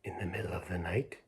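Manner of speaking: soft fast whisper. A narrator's reading. Bedtime story, whispering the middle of the night. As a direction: hush it like the lights are out.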